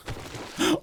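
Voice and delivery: quick startled gasp; sharp gasp. Real, not acted. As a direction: gasp in sudden fright. Sudden fright while sneaking.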